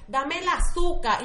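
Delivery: mild mock mother voice; mom's voice. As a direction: play the mother calling for the sugar.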